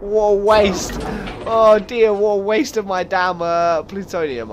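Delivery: loud, high annoyed groan; frustrated. Real, not acted. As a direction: wail at the wasted fuel. Landed by accident, wasted fuel.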